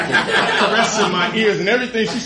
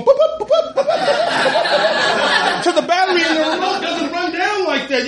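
high squeaky boop boop boops; laughing high-pitched beeping imitation; mock remote beeps; 13 semitones higher than usual. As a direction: mimic the remote chirping over and over, laughing. Mocking friend pressing remote endlessly.

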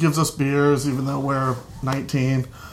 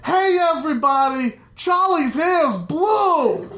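mock yell; 10 semitones higher than usual. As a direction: announce it to everyone downstairs. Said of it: "yell to everyone downstairs".

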